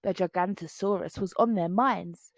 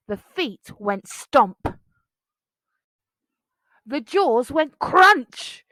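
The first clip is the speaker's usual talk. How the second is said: slow dramatic; 2 semitones higher, 6 dB louder, slower than usual. A narrator's reading. Monster attack climax.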